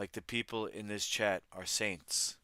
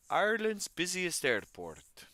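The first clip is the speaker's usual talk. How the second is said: hammed-up Irish accent, much higher and louder, slow; terrible Irish accent; 11 semitones higher, 7 dB louder, slower than usual. Streamer hamming up the Irish accent for chat. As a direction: overdo the Irish lilt on purpose. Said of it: "That was a terrible Irish accent".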